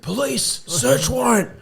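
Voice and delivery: high, far-off mock yell of the raid call; police shouting. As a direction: call it out like officers bursting in. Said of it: "Then I heard".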